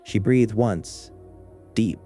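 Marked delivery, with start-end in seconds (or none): none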